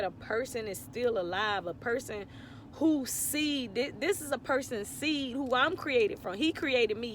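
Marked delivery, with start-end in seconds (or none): none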